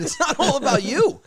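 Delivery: laughing retort. Retold or imitated. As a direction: shoot it back laughing. Retort to father.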